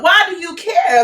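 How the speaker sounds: ordinary talk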